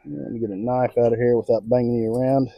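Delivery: ordinary delivery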